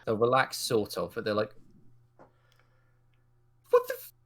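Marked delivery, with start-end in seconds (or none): 3.7-4.1 high shocked yelp; startled outburst. Acted. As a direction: blurt it out in shock. Startled NPC exclaims.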